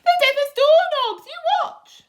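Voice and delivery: high, loud brash bug voice; cocky centipede. Acted. Centipede boasting to the others, high and loud. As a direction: show off to everyone. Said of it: "he cried".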